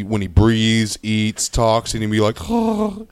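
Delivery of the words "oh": high-pitched mock wheeze; short, high comic huff; noisy breathing imitation. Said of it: "he makes a lot of noises when he".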